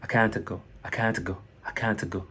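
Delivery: long-A "kaant" for "can't", lighter T; CAN'T, T easing off. Shown as a demonstration. Teacher drilling away the precise T, long-A "can't". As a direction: ease off the T of "can't". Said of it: "la précision que vous faites sur le T".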